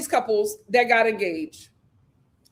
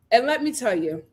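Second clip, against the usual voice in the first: normal talking voice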